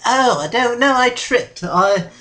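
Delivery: high, loud, stumbling alibi; flustered excuse. Acted. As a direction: blurt a hasty excuse after being caught. Host caught out, invents an excuse.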